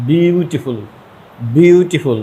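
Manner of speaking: "বিউটিফুল" pronounced carefully so the several syllables show; syllable demonstration. Shown as a demonstration. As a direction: sound out "বিউটিফুল" with a lengthened first syllable. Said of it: "এটা কিন্তু এক syllable নয়".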